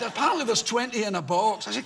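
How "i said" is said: ordinary talk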